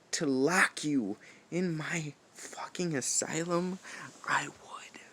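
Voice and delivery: soft, breathy threat; menacing whisper. Acted. Warden threatening to lock the rival up, menacing whisper. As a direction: whisper it like a secret promise.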